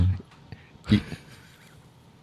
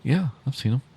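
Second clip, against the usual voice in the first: hesitant, slightly raised pitch; unconfident; 6 semitones higher, 3 dB quieter than usual. Real, not acted. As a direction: answer as if not quite sure. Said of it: "You didn't sound very confident".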